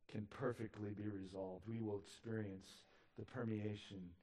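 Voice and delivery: hushed preaching. Acted. Hushed sermon passage.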